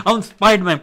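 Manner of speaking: put-on loud, high kid voice; excited kid shout. Kids going around shouting.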